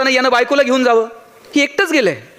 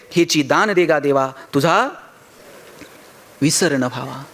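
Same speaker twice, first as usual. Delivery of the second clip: slow, lower-pitched devotional verse; verse recitation; 7 semitones lower than usual. Acted. Tukaram asking God for his boon, slow reverent recitation. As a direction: recite it slowly, letting the pitch settle.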